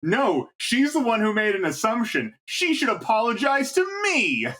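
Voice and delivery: high, rising to a shout; mock bratty shout; bratty and indignant. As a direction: whine it like a spoiled brat. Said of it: "indignant and bratty and says".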